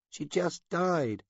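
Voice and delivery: put-on high child voice dragged out slowly, close to crying; he repeats it, barely holding together; slow tearful insistence. A narrator's reading. Child repeating denial, slower.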